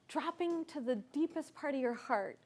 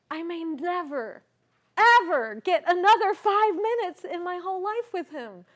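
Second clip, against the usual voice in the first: urgent; 4 semitones higher, 10 dB louder than usual. Real, not acted. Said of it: "urgency of the rare chance".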